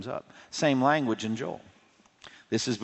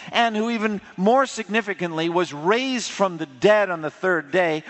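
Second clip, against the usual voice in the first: higher and louder, driving each phrase home; preaching at full force; 8 semitones higher, 3 dB louder than usual. Acted. Preacher urging the congregation to call on Jesus, ringing high and loud. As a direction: build the sermon to a ringing climax.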